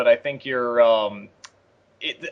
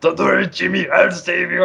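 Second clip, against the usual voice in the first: put-on raised-pitch Schwarzenegger accent; Schwarzenegger impression; 4 semitones higher than usual. Commentator quoting the demo reel line to Jimmy, Schwarzenegger imitation. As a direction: imitate Schwarzenegger promising a rescue. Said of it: "Every time I do that".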